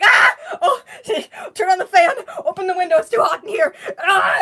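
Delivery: imitating mom's frantic yelling, high and loud; hammed-up panicked shouting; mock panicked mom. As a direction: play mom panicking, shrieking orders. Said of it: "my mom's freaking out".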